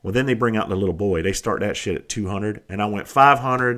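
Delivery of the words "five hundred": louder, higher called-out bid; bold bid. Retold or imitated. Bidder jumps straight in at auction, bold and loud. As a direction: call the figure out over the room. Retells jumping in with high bid.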